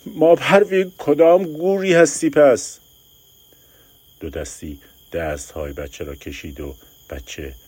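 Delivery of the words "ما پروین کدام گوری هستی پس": high loud slow furious call; angry shout. Acted. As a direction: yell at her for being gone. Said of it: "داد زد". (فریاد خشمگین و بلند)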